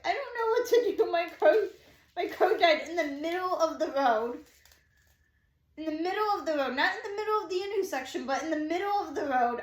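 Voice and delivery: loud, high panicked retelling; frantic quote, high and loud; retold freak-out. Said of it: "I'm, like, freaking out".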